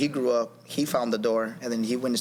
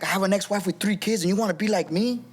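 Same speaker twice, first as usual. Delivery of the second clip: raised-pitch mock telling-off; retold yelling; 5 semitones higher than usual. Father warning his son off his path, mock yell. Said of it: "he started yelling at me".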